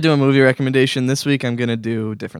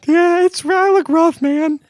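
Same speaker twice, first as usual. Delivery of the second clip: high falsetto woman's voice; man doing a woman's voice; 3 dB louder, slower than usual. Pretend. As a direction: squeak it out like a girlfriend on the phone. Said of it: "Do you like my girl voice?".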